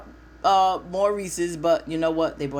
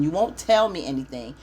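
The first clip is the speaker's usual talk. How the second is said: normal talking voice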